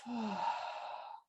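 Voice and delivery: audible exhale through the mouth; long sigh out. Shown as a demonstration. Yoga teacher showing the out breath, long audible sigh. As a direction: let a long, soft breath out. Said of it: "then out through the mouth".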